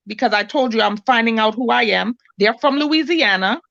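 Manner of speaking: ordinary delivery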